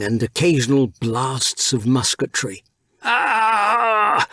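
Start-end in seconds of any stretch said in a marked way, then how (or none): 3.0-4.3 sharp high pained yelp; a pirate yelping as he stumbles; pirate's cry. A narrator's reading. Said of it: "a pirate shouted".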